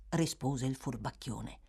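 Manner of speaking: ordinary delivery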